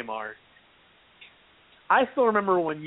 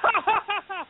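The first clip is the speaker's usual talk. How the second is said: worst fake laugh. [name] mocking his foe with a fake laugh. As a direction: force out a terrible villain laugh. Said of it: "the worst fake laugh ever".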